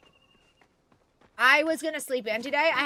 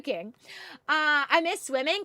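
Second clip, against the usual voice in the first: normal talking voice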